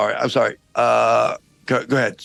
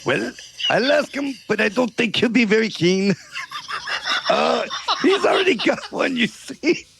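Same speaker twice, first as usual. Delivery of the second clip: put-on French accent. French guard calling down from the castle wall, hammed-up French accent. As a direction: ham up a silly French guard. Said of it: "this outrageous accent".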